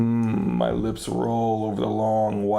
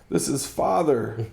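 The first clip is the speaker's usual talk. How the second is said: ordinary delivery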